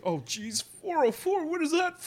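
high, loud startled cry; mock surprise. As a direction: yelp in fake alarm. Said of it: "He's like".

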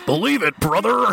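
high hammed-up wrestler shout; a hint of a hype wrestler; mock wrestler voice. Wrestler-style catchphrase bit.

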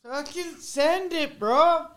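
high, slow, hyped bro yell; frat bro. Acted. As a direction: stretch it out like a pumped frat bro.

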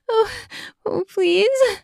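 high, pleading moan; breathless begging. Acted. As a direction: beg him, high and breathy with want. Heroine begs aroused.

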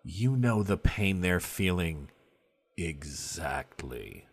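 low voice, slowing to a hush; grave revelation. Acted. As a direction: land EXACTLY very slowly, low and quiet. Game master pointedly reveals shared pain.

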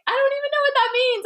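high, fast, squeaky bewildered voice; baffled. Retold or imitated. Newly told she's an empath, squeaking in bafflement. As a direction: act totally lost, pitch shooting way up. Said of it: "And I was just like".

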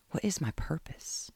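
whisper. Acted. Kate questioning herself aloud.

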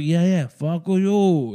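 slow, drawn-out mock French dismissal; mock French accent. French troops mocking the British, slow mock French accent. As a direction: stretch it out like a sneering Frenchman. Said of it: "Is that French?".

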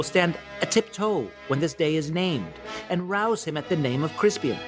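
stirring king's speech to his soldiers; amateur Shakespeare recital. Acted. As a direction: declaim it grandly, lifting key phrases high. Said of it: "presented to you by me in my".

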